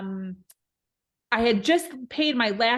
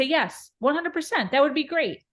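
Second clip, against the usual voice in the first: eager yes; 6 semitones higher than usual. Retold or imitated. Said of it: "I say".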